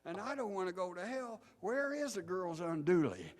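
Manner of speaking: put-on high, pleading kid voice; terrified boy. Boy begging the preacher to explain, mock terrified. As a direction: build to a loud, bewildered wail. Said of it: "The terrified boy says".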